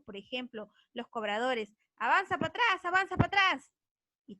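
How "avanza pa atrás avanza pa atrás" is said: high, loud, shifted bus-tout chant; bus conductor call. Shown as a demonstration. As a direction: holler it like a bus conductor hustling riders. Imita a cobradores de combi. (cobrador de combi gritando, agudo y fuerte)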